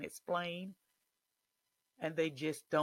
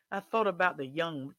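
ordinary talk

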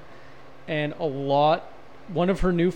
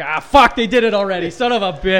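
loud, exasperated mock outburst; mock frustration; 4 semitones higher, 8 dB louder than usual. Realising Fight Club already did it, mock frustrated. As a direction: curse it as a comic self-reproach. Acts out realising idea was taken.

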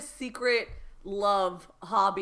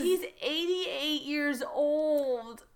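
slow, wailing mock despair; slow half-laughing wail; drawn-out lament; 4 semitones higher, much slower than usual. Real, not acted. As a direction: stretch every word out in mock anguish.

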